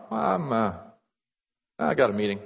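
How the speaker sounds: hesitant, dropping off at the end; reluctant evasion. Acted. Embarrassed churchgoer answering a friend, reluctant. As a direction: dodge the question half-heartedly.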